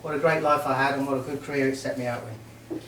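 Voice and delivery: normal talking voice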